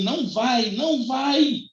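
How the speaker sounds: high, pleading shout after the dog; calling out. Retold or imitated. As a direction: yell to stop him at the gate. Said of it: "e eu gritando assim". (gritando para o cachorro não ir)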